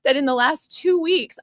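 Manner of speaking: ordinary delivery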